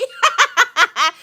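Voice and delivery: bright burst of high laughter; a quick high laugh that falls away. Real, not acted. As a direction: laugh out loud, delighted. Laughs at the Pookie nickname.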